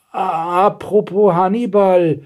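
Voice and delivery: high, drawn-out eager call; excited. A narrator's reading. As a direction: pipe up with sudden excitement. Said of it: "rief Blaustrümpfchen aufgeregt". (aufgeregter, gedehnter Ruf)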